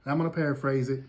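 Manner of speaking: ordinary talk, nothing marked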